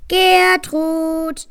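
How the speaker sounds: loud call. A narrator's reading. Parents calling their daughter home, loud call. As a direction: call across the cliff. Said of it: "riefen die Eltern laut".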